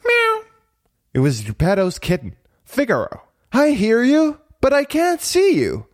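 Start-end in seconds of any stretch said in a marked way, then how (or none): 0.1-0.4 tiny high-pitched imitated mew; kitten meow. A narrator's reading. Geppetto's kitten answering from the workshop, meowing. 3.5-5.9 put-on high boyish puppet calling. A narrator's reading. Pinocchio looking for the hidden kitten, calling out. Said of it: "called Pinocchio".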